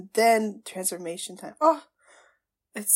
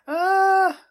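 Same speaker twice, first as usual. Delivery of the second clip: high, loud cry after a gasp; gasp, then let out a startled "ah"; 10 semitones higher, 10 dB louder than usual. Real, not acted. Fan startled by the episode, high loud cry.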